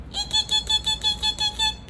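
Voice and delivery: high squeaky eeks; squeaky bat voice. Shown as a demonstration. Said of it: "Can you say that too?".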